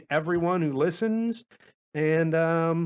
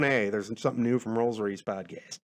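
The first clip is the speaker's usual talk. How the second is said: phone notification voice; 6 semitones lower, 4 dB quieter than usual. Retold or imitated. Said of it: "a quick little message".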